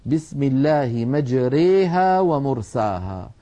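slow recitation with مجريها said as mejreha and ومرساها fully open; recited with imala. Shown as a demonstration. Teacher reciting Hafs's reading, slow with مجريها bent. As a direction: recite slowly, bending only مجريها toward e. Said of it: "هكذا رواها حفص عن شيخه عاصم".

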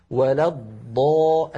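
slow, low "ولا الض" lengthening the dad; still dad held. Shown as a demonstration. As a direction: press and hold the dad ending "ولا الض". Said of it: "أتكلم على الضاد الأولى". («ولا الض» بضاد ساكنة مطوّلة)

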